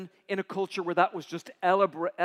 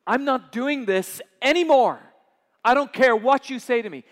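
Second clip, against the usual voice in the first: defiant refusal; 6 semitones higher, 11 dB louder than usual. Acted. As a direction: dig in and refuse God out loud. Prophet refusing God angrily.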